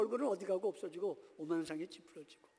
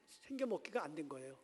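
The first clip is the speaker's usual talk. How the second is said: usual speaking voice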